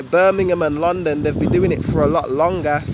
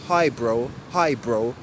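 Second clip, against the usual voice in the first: "Hi, bro" with BRO quicker than HI; quicker ending; 5 semitones lower, slower than usual. Shown as a demonstration. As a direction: clip "bro" shorter than "Hi". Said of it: "always quicker than the first one".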